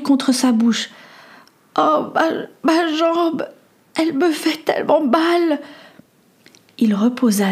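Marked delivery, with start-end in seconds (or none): 1.8-5.6 faint in pain. Acted. Injured girl whispers faintly.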